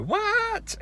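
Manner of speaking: a whole world asking what, mock disbelief; baffled what. Everyone online seeing the mystery Pokemon, mock baffled. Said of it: "made everyone go".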